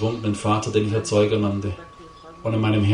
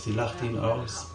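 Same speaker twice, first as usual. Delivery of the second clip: softer and slower, letting it sink in; quiet, slow echo; 8 dB quieter, slower than usual. Acted. Preacher echoing his point to the congregation, hushed and slow. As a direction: drop to a hushed, slow repeat. Repeated line, second softer.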